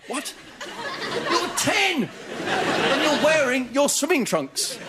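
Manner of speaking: high, slow incredulous cry. Real, not acted. Incredulous at armed kid.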